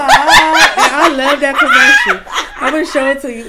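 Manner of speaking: loud slow laughing line; laughing shout. Real, not acted.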